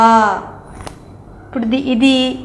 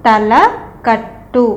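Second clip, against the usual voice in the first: very slow demo; much slower than usual. Shown as a demonstration. Teacher slowly spelling out తలకట్టు for kids. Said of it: "తలకట్టు వచ్చింది".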